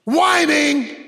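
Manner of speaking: loud, high preacher's cry; full-voiced sermon climax; preaching at full force.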